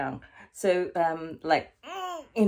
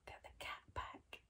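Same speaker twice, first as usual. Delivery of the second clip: whisper; 24 dB quieter than usual. Real, not acted. Whispering at the end.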